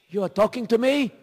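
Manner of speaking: a hint of a startled old man; lightly voiced old man; surprised old man.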